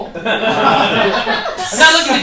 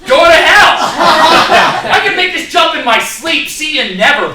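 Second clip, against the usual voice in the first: much higher, louder petty rant; mock indignant rant; 9 semitones higher, 11 dB louder than usual. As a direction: storm off in a childish huff. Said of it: "He's like".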